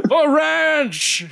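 high rallying shout; battle cry. Pretend. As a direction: yell it like charging into battle.

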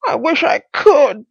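high, loud strained character voice; wheezing Pycraft. A narrator's reading. Pycraft stuck on the ceiling, wheezing. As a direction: push it out high, as if short of breath. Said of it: "he wheezed".

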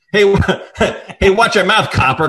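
loud, high squawk with a laugh caught in it; hammed-up shrieking secretary; mock cry. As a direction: yell it again, breaking up. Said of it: "cried Winston again".

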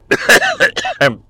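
harsh coughing after choking; hacking cough, something down the wrong pipe; coughing fit. Real, not acted. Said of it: "tossendo all'infinito". (tosse violenta, come soffocato)

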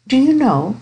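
mildly anxious, high-pitched niece; anxious to smooth over. A narrator's reading. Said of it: "anxious to prevent anything unpleasant".

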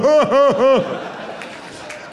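high manic cackle; Jack Nicholson laugh. Retold or imitated. Comic imitates grandma's manic laugh in The Shining. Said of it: "Se ríe como Jack Nicholson".

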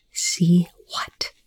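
hushed, insistent question; tense whispered demand. A narrator's reading. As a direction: whisper it, pressing for an answer. Frightened character repeats his unanswered question.

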